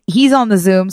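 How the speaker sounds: ordinary talk, nothing marked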